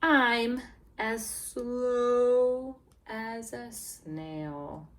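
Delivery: drawn-out slow reading. Shown as a demonstration. Teacher reading to kids, dragging the words slowly. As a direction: stretch it out like a snail.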